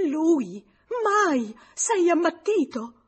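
high, very loud outraged cries; screaming like a beast. A narrator's reading. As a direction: shriek your refusal in fury. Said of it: "lanciò un urlo come d'una belva".

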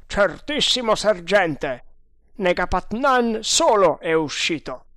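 high, loud, emphatic assurance; certain scout. Acted. Indian assuring his doubting sergeant, emphatic.

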